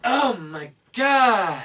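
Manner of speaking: drawn-out loud amazement; slow amazed gasp. Acted. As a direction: stretch it out in awe. Echoing mock amazement.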